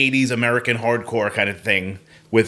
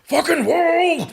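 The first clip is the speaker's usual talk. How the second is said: high, drawn-out hardcore singer parody; mock performative singer; much slower than usual. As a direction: stretch it out high like a put-on punk vocalist. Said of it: "you don't go like".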